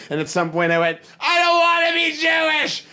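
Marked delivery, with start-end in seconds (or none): 1.2-2.9 high, loud mock child's cry of protest; childish wail. A crying child protests to his grandfather, high mock wail. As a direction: wail it like a crying kid throwing a tantrum. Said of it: "I'm crying hysterically".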